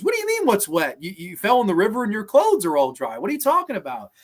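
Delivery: high-pitched, disbelieving retort; incredulous. Retold or imitated. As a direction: imitate someone baffled and challenging the answer.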